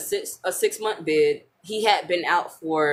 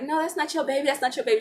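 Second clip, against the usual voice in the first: higher, insistent mock denial as mom; mimicking mom's denial; 9 semitones higher, faster than usual. Retelling how mom denied the baby was dad's, higher insistent voice. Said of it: "Mom was like".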